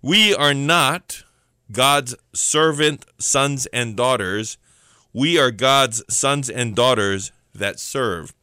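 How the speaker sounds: slow, deliberate, every word spaced out; slow preacher's emphasis with long pauses; said real slow. Shown as a demonstration. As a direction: lay each word down slowly so it sinks in. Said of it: "Let me say that again real slow".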